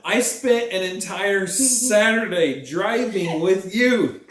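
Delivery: high, drawn-out exasperated protest; mock-indignant rant. Real, not acted. Reminding partner of the car-buying favour, mock-indignant.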